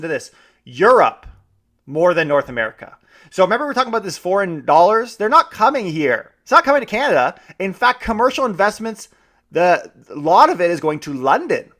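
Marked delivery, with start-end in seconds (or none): none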